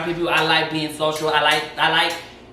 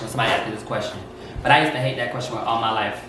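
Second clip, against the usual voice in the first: ordinary talk, nothing marked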